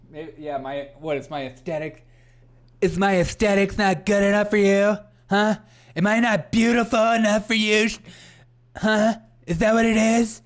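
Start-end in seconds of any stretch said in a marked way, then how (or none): 2.8-10.4 high, loud hammed-up indignation; playfully outraged yelling; mock offended. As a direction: demand it like a wounded diva, loudly. Mock outrage at chat's remark.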